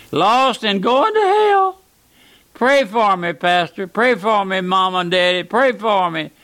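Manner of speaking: high, pleading cry of a lost soul; wailing lost sinner. Retold or imitated. Preacher voicing a lost sinner begging pastor and parents for prayer. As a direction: beg for prayer like a sinner crying out. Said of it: "the wailing cries of a lost sinner saying".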